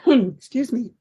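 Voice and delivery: usual speaking voice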